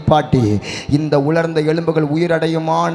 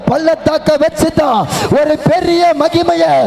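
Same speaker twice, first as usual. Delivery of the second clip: climbing louder and higher to the close; building preaching; 7 semitones higher, 5 dB louder than usual. Acted. Pastor assuring the grieving congregation, rising preaching. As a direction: lift each line toward the promise.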